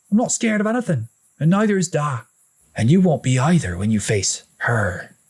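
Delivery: put-on high, flustered boyish protest; spluttering denial. A narrator's reading. Older brother spluttering a denial to his brother, high voice. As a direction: insist he's brave, too fast to think. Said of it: "Kieran spluttered".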